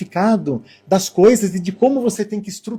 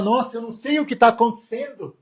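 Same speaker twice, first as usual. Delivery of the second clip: higher, louder whiny lament; whiny complaining; 6 semitones higher, 9 dB louder than usual. Retold or imitated. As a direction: moan about how hard life is. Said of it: "e você fala".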